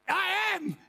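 over-the-top enthusiastic believer; fired-up eager reply. Acted. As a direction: yell it like you can't wait to go. Eager believer answers God.

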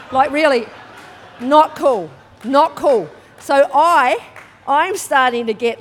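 loud, stern haka-style call of not cool; loud warrior-challenge delivery; haka-style shout. Retold or imitated. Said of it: "Have you guys seen the haka?".

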